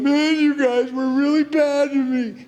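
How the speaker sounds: high, whiny hurt-brother voice; mock whiny complaint. Pastor imitating Joseph whining at his brothers, high and whiny. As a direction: whine it like a wounded sibling. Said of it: "He's not going".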